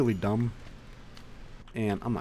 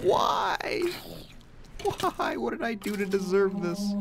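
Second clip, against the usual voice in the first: high-pitched, hammed-up crying lament; anguished wail; 11 semitones higher, slower than usual. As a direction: wail at the unfairness of it. Wails after repeated misfortune in game.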